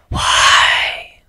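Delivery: unvoiced hiss from hiding. A narrator's reading. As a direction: spit the word in a hiss. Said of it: "a voice hissed".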